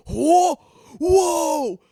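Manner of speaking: very high, loud excited whoa; high, loud shouted bro whoops; hyped crowd whoa. Retold or imitated. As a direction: whoop like bros who loved the burn. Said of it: "these dudes literally go".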